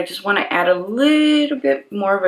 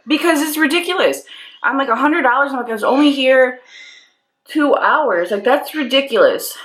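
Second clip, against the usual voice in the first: indignant; 7 semitones higher than usual. Retold or imitated. Arguing with the attendant.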